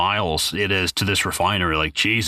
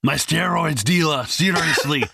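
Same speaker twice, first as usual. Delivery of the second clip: mock earnest; 5 semitones higher than usual. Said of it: "He's like".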